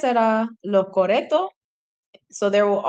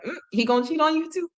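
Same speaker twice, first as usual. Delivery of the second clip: sassy retort; 8 semitones higher, faster than usual. Retold or imitated. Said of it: "and she's like".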